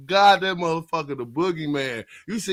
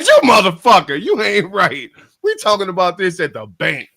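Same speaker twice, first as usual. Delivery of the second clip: mock outburst; 3 semitones higher, 6 dB louder than usual. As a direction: yell it in playful outrage. Retold outburst at bank.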